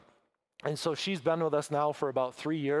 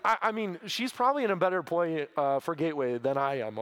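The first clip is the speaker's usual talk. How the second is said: ordinary talk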